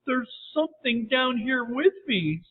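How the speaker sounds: high, mildly frightened voice; shaky voice. A narrator's reading. Sally to James from the cave, shaky voice. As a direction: let a touch of fear in. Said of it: "replied in a shaky voice".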